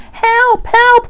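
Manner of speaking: high, loud panicked cry; squealing alarm; Piglet. A narrator's reading. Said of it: "cried Piglet".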